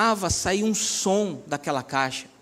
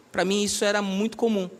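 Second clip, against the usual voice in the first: ordinary talk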